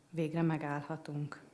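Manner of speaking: ordinary talk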